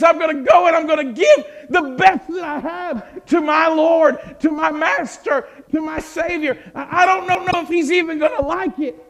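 high, loud, breaking cry of devotion; tearful cry. Acted. Mary weeping as she vows her best to the Lord, loud and high. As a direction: cry it out as if overwhelmed. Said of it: "she just starts to weep".

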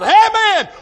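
high, loud preacher's amen; exultant shout. Real, not acted. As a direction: shout it out in praise. Exultant praise at climax.

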